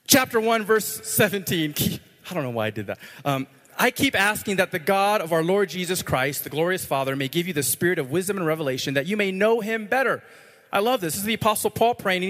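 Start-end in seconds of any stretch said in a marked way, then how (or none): 3.8-10.2 raised, driving scripture reading. Acted. Preacher reading Paul's prayer to the congregation, raised and emphatic.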